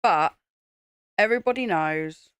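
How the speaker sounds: ordinary talk, nothing marked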